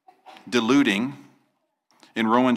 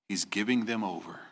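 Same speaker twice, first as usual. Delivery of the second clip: dramatic whisper; 2 semitones lower, 7 dB quieter than usual. Acted. Preacher hushes the verdict.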